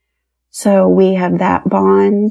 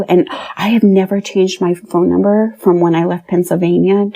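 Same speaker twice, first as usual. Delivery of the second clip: ordinary talk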